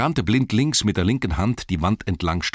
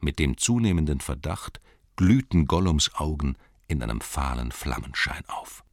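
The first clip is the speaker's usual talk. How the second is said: usual speaking voice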